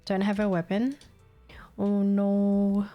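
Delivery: normal talking voice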